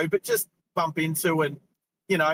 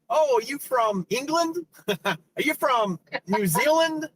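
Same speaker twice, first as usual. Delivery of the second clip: higher, shouted mock question with a laugh; mock curious American; 6 semitones higher than usual. Streamer mimics Americans quizzing an Aussie, higher mock shout. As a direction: ham up a nosy stranger guessing your accent.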